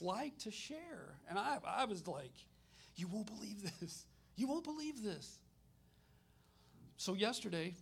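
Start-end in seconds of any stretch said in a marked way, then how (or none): 3.0-4.1 contained, understated disbelief; mild amazement. Retold or imitated. Speaker retells his reaction to sharing, mild amazement. 4.3-5.4 much higher, excited repeat; excited amazement. Retold or imitated. Speaker retells his reaction to sharing, excited amazement.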